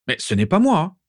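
Régulus defends himself to his parents, protesting; defensive. A narrator's reading. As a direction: push back against the accusation. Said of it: "se défendit Régulus".

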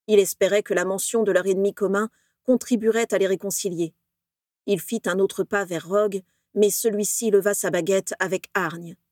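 ordinary delivery, nothing marked

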